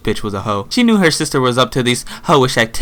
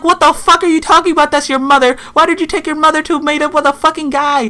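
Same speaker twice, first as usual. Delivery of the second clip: shocked outburst. Retold or imitated. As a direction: blurt it out in high-pitched shock. Shocked outburst at meeting the mother.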